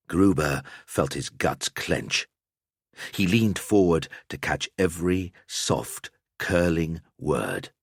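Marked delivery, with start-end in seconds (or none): none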